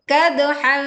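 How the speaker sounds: "kadhan" with a veiled, held nasal ending; merged tanwin. Shown as a demonstration. Teacher showing tanwin merged into fa, blurred nasal ending. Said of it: "leburkan ke fa menjadi".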